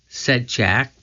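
plain reading voice

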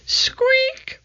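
very high, tiny mouse voice; mouse squeak. A narrator's reading. Little mouse appearing among the books, high squeak. Mouse answers Annie's question.

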